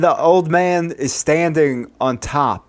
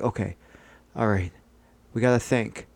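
lower, softer, taking stock; hushed regrouping; 5 semitones lower, 7 dB quieter, faster than usual. Acted. Friends deciding about the body, hushed and low. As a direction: drop low and quiet to think it through. Characters collect themselves to plan.

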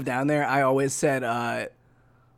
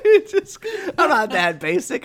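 high, loud, through real laughter; laughing protest; 9 semitones higher, 5 dB louder than usual. Real, not acted. Quiz taker objects to getting Coke, laughing loudly. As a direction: protest while cracking up.

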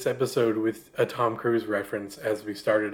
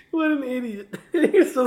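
laughing; 10 semitones higher than usual. Real, not acted. Laughing at co-host's impression.

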